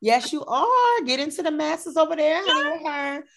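very high, playful cheerleading; hyping up. Real, not acted. Host hyping guest's messy Twitter, high and excited.

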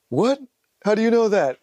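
sharp gasp, then high-pitched mock disbelief; gasping imitation of being stunned; imitated astonishment. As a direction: act out her startled, high-pitched surprise. Acts out her astonished reaction.

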